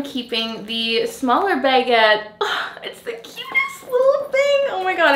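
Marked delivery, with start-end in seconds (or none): 2.8-5.1 much higher, squealing adoration; cooing delight. Real, not acted. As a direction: coo over the tiny bag. Unboxing the small bag, cooing.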